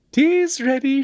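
put-on sing-out cheerful girlish voice. A narrator's reading. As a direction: call it out gaily in falsetto. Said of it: "she cried gaily".

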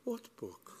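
high, small innocent query; strained poise. A narrator's reading. Cyril keeping his poise when accused, soft high innocence. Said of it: "maintained his poise with a strong effort".

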